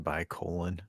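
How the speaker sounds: "Colin" with a long O, said softly; name said like Powell's. Retold or imitated. Podcaster repeating a farewell he heard, "Colin" pronounced COH-lin. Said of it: "like Colin Powell".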